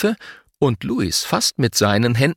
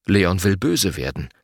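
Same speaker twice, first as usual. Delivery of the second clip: plain reading voice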